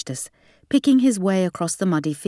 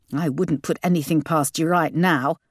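usual speaking voice

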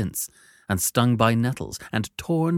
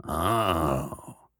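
gruff convict; 4 semitones lower, 3 dB quieter than usual. Acted. Convict reacts.

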